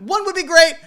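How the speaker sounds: cheeky reply. Retold or imitated. DJ's cheeky comeback.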